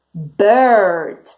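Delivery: ordinary delivery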